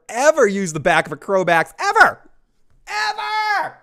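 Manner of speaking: rising to a full shout on EVER; emphatic shout. Acted. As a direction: yell the last EVER with full force. Stressing Lalo would never do it.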